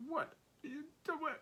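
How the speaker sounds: high, pained French cousin's plea; pained Frenchman. Retold or imitated. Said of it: "he looks at me so pained".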